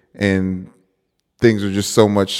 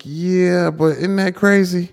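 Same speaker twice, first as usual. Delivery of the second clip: incredulous quoting self; 10 semitones higher, slower than usual. Retold or imitated. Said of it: "It's like".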